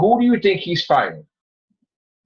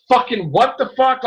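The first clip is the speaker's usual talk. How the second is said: shocked outburst; 8 semitones higher than usual. Retold or imitated. Hulk shocked in retelling.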